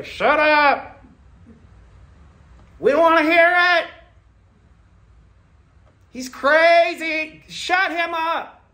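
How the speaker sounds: loud, high furious yelling from the crowd; angry crowd shouting. Retold or imitated. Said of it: "These people of love began to shout like that".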